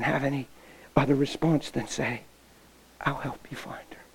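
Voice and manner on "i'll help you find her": soft unvoiced whisper; broken whisper. Girl whispering her surrender to the pastor. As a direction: whisper it like you've given in.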